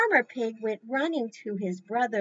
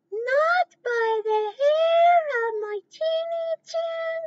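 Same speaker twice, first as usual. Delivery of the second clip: much higher, louder squeaky little pig; 7 dB louder, slower than usual. Acted. Little pig refusing the wolf, much higher. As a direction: squeal your defiance at the wolf. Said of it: "Good pig voices".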